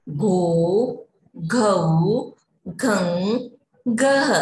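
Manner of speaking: slow paced teacher chant, each syllable set apart; syllable drill recitation. Shown as a demonstration. Teacher leading children through the क row, slow recitation. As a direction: recite the vowel chart for little kids.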